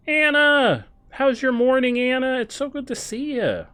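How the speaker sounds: high, loud sing-song hello to Anna; gushing greeting. Acted. Player greeting Anna, high and gushing. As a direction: beam it out like she's your best friend. Cheery greeting to game character.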